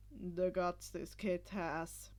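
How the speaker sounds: gruff, grudging praise; rough low voice. Acted. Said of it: "much rougher than the previous Eraserhead".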